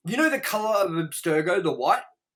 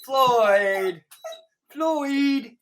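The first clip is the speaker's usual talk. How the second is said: high, drawn-out name call; raised, lengthened call to a pet; calling the dog; 7 semitones higher, 4 dB louder, much slower than usual. Real, not acted. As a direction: sing out the name to get the dog's attention.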